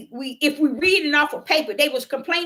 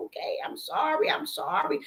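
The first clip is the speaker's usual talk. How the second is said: soft, breathy mock apology; 1 whispered apology; 8 dB quieter than usual. Preacher quietly retelling her apology to the grieving sister. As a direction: hush it like a sheepish sorry.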